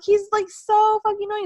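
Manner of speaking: loud, high-pitched griping; retold exasperation. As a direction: complain in worked-up indignation. Retold argument, ranting about wrestler.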